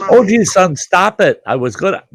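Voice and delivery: high, loud mock protest. O G son waving off the praise, loud high protest. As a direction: protest it loudly in jest. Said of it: "he says".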